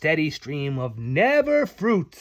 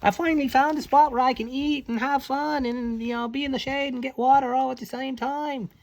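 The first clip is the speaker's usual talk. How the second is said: high, loud cheering; happy frogs; 9 semitones higher, 4 dB louder than usual. Acted. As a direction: whoop like delighted baby frogs. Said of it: "these little guys are all like".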